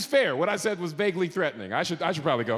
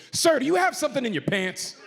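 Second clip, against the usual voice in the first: higher-pitched, confrontational agent; with an attitude; 7 semitones higher than usual. Retold or imitated. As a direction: imitate an officious agent challenging a passenger. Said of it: "comes at me with an attitude".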